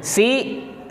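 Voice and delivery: ordinary talk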